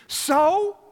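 sharp loud So? thrown at the crowd; loud challenge. Real, not acted. Preacher to grudge holders, a loud sharp challenge. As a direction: challenge them, loud and high.

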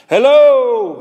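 loud, high drawn-out holler; calling into cave. Acted. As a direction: shout hello into a cave. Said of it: "standing at the mouth of the cave saying".

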